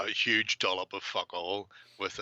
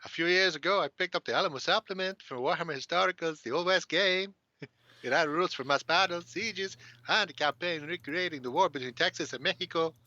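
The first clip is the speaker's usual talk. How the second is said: hammed-up Mexican accent, pitched higher; 8 semitones higher than usual. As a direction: read it in an overdone Mexican accent. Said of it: "we need to put on our best Mexican accents".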